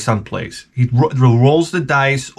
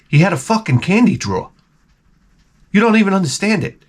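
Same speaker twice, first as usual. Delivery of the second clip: normal talking voice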